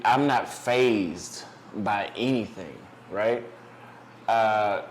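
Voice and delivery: ordinary talk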